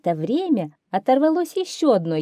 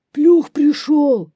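very loud, high wolf cry; wolf repeating the alarm; 9 semitones higher, 9 dB louder, slower than usual. A narrator's reading. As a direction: howl the alarm out slowly. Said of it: "повторяет волк". (волк кричит высоко и протяжно)

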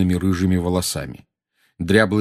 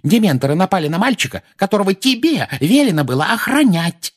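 high, loud accusation; high-pitched angry tirade; furious scolding; 12 semitones higher, 5 dB louder than usual. Acted. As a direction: berate the guard for failing. Woman berates negligent guard.

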